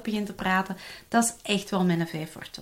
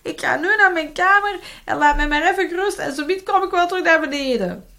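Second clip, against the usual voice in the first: put-on high, louder kid announcing a break; child's voice; 9 semitones higher, 8 dB louder than usual. Child telling the parent he goes to his room, high kid voice. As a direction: play the child claiming a time-out. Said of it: "Gaat hij ook op een bepaald moment zeggen".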